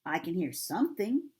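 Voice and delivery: calm, natural-pitched reply; unworried character line in an everyday voice; lightly voiced Christopher Robin. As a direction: answer evenly, with curiosity on the last word. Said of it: "said Christopher Robin".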